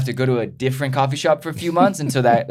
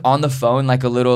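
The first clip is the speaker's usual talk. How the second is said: ordinary delivery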